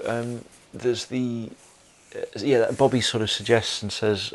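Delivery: ordinary talk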